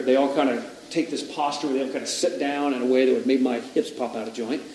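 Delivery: ordinary talk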